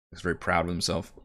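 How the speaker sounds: ordinary delivery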